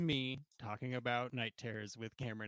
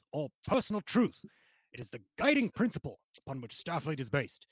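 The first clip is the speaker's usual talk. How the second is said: theatrical captain's speech, pitched up on key words; Picard; 2 semitones higher than usual. Shown as a demonstration. [name] performing on the host's request, captain impression. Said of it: "your Picard impression".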